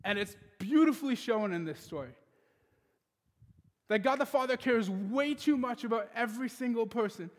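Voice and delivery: full preacher's cadence; preaching. Acted. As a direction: preach it to the congregation with conviction. Preaching the sermon point.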